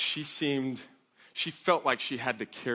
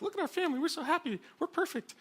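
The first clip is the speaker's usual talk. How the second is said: high, softer mock-sweet voice; perfect-family poster; 4 dB quieter, faster than usual. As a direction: gush in a mocking high-pitched voice. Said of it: "women that post all the nice pictures".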